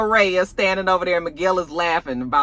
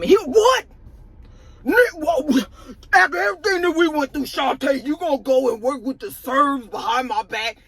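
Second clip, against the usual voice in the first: mock outraged boyfriend; 8 semitones higher than usual. Jannard confronts Shantae angrily.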